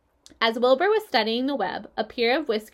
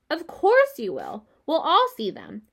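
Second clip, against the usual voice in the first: eager reassurance; 5 semitones higher, 4 dB louder than usual. A narrator's reading. Pig eagerly reassures the spider.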